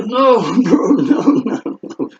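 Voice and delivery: high, loud pleading "no" broken by a laugh; laughing protest. Retold or imitated. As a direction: laugh through a nervous refusal. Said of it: "I was not laughing at the time".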